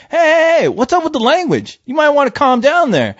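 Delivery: high-pitched mock objection; retold protest. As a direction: act out cutting in on an angry man. Retold argument with cursing cop.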